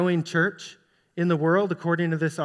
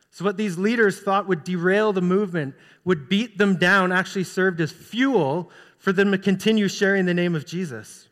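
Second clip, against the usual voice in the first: normal talking voice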